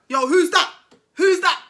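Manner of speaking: retold calling out. Man answering banging at his door, loud call. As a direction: call out through the closed door.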